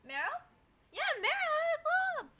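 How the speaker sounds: high excited squeal; loud, high and thrilled. Real, not acted. Excited reacting to chat.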